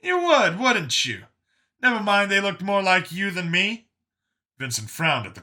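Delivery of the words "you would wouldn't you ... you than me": high, loud scoff; Brian's scoffing voice. Acted. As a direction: scoff in a raised character voice. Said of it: "Brian snorted".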